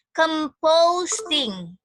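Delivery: Composting drawn out very slowly, clearly; slow dictation. Shown as a demonstration. Teacher repeats the word for listening practice, slow dictation. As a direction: dictate Composting with long, stretched syllables.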